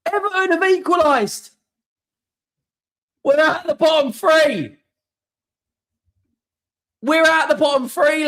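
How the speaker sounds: high, loud, euphoric yelling; ecstatic shouting. Real, not acted. Streamer yelling to his viewers that they're safe, euphoric. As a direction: scream the good news to the chat. Fan celebrating equaliser on stream.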